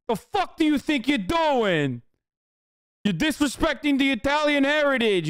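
high, hammed-up outraged Italian chef; squeaky mock outrage at a disrespected dish; mock angry judge. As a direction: play the offended judge defending his cuisine. Streamer voicing the angry judge.

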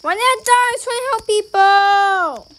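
loud, high-pitched protesting wail; wailing complaint. Real, not acted. Complaining after dying in game.

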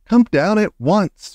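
firm, loud, slow call upward; grandmother calling up. A narrator's reading. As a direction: call the boy down firmly. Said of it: "she called out".

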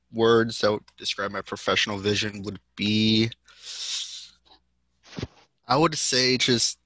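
usual speaking voice

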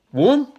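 sudden loud high yelp; loud yelp sound. Acted. Dog's tongue released.

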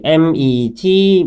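slow letter-by-letter spelling; slow teacher spelling. Shown as a demonstration. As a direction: spell out P E E L one letter at a time. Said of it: "spelling the word out".

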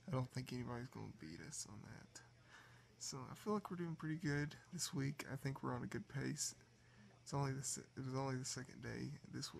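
soft, tired late-night voice; drowsy, about to fall asleep; sleepy. Real, not acted. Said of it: "I'm about to fall asleep".

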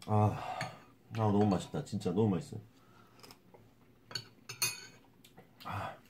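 chewing while talking; talking between bites of rice; mouth full. Real, not acted. Seen 0: took bite of curry rice just before; eating throughout.

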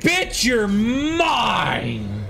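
high, long drawn-out gloating shout; triumphant battle cry. Real, not acted. Player claiming an enemy, triumphant battle cry. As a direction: stretch it out in triumph.

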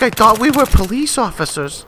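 high-pitched put-on teammate voice; puzzled teammate. Teammate doubts the ninja plan, high puzzled voice. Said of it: "He's like".

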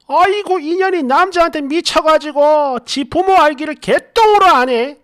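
shrill-free high, loud wail of a mother; mom screaming. Retold or imitated. Mom screaming at her daughter about the boyfriend, high loud yell. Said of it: "난리치고 소리 지르는데".

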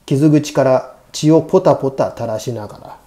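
plain reading voice

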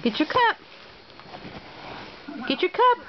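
high, rising sing-song coaxing. Real, not acted.

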